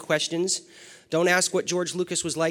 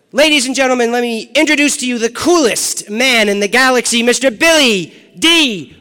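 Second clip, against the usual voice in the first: big arena introduction; 8 semitones higher, 13 dB louder than usual. Real, not acted. Host introduces the star to a convention crowd, rousing announcer shout. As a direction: belt out the guest's name, drawing it out.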